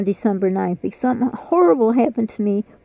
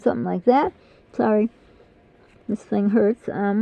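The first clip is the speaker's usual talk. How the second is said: usual speaking voice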